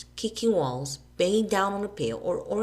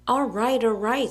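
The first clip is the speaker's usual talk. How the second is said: high, loud hasty give-in; stalling; 6 semitones higher, 6 dB louder than usual. A narrator's reading. Billy stalling Alan, hasty. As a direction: play for time. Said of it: "playing for time".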